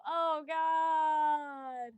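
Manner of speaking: loud, long drawn-out lament; big theatrical dismay; dramatic groaning exclamation. Real, not acted. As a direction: wail it out long and high. Reacting to the Scorpio placement.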